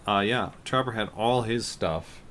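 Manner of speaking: ordinary talk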